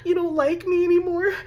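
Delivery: high, whiny fake sobbing; hammed-up tearful wail; mock crying. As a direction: blubber like a needy new girlfriend. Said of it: "she might start crying".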